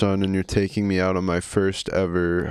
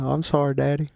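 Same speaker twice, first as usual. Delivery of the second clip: higher-pitched Southern son; Southern accent; 4 semitones higher, faster than usual. Acted. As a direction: apologise like a Southern boy to his daddy. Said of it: "Southern accents?".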